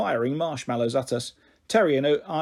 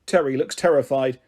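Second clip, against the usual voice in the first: plain reading voice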